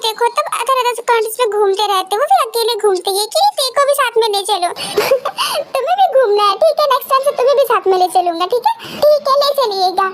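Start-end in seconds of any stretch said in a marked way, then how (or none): 4.7-5.7 a high, soft burst of laughter; hearty laugh. Acted. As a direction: laugh off the teasing. Teasing uncle laughs.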